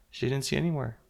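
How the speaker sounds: plain reading voice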